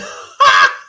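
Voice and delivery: short, low, harsh mock shouts; imitated dad noise. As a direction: bark out wordless bursts to spook. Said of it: "you see my dad's like".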